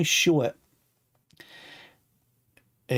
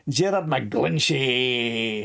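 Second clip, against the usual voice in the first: slow, relished Gerard McGlinchey, every syllable drawn out; savouring the name; much slower than usual. Shown as a demonstration. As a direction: roll the name out slowly, loving its sound. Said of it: "I say it like that 'cause I".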